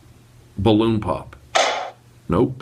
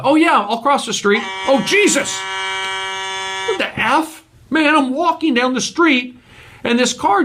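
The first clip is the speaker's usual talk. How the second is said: startled; 8 semitones higher than usual. Pretend. Startled by a car on the street.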